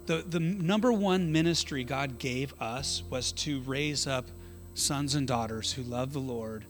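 normal talking voice

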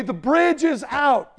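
"The bridge is out" shouted loud and high, slowly; slow urgent yell of "The bridge is out"; loud warning to a driver. Retold or imitated.

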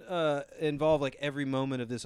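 usual speaking voice

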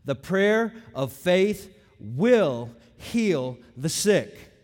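WILL and HEAL set apart, very slow and weighty; slow, word-by-word stress on "will heal"; emphatic. Shown as a demonstration. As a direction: land hard on "will", pausing before "heal". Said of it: "Notice the emphatic language here".

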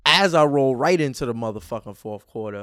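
loud, fired-up announcement; worked-up anger. Pretend. Podcaster opens the next segment, loudly angry. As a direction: launch in hard, playing up the rage. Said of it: "my anger is at a thousand right now".

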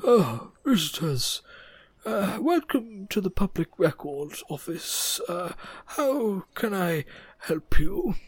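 slow, soft polite clerk voice; lightly voiced slow records clerk; grey centaur clerk. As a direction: greet visitors like a sleepy records keeper. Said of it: "grey centaur turns around and goes".

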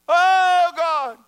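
very high, loud mock holler; hollering at God. People praying to a distant God, hammed-up holler. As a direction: imitate people crying out to someone far away. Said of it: "people holler at God".

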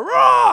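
very high, explosive imitation roar; loud roar leaping high; lion's huge roar. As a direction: let out a full-blast roar. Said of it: "he sent out this roar".

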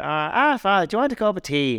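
put-on high fussy old housekeeper voice; Mrs Doyle. Mrs Doyle offering the priest tea, high eager imitation. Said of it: "she always comes in and is like".

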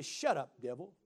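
low, soft, unbothered command; quiet rebuke. Acted. Child of God to the devil, quietly dismissive. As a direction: dismiss him without raising your voice.

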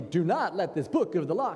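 overdone sing-song public recitation; declaiming out loud. Shown as a demonstration. Reciting scripture aloud where it would embarrass, declaimed. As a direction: proclaim it with exaggerated swoops. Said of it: "'Cause you don't wanna be".

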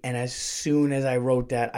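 ordinary talk, nothing marked

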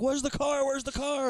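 hammed-up frantic repeat, pitch shooting up; mock frantic. Teller imitates the man searching for his car, mock frantic.

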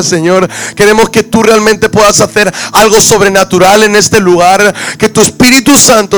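impassioned, rolling public prayer; fervent prayer. Real, not acted. Preacher leads the church praying to the Lord, fervent prayer. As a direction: pray out with real fervour.

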